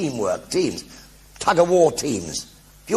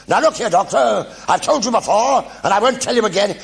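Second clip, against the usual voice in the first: stern brigadier, higher and louder; 9 semitones higher, 5 dB louder than usual. Retold or imitated. Nick as the brigadier scolding the Doctor, loud and high. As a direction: bark it like an indignant officer. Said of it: "he looked at me and he said".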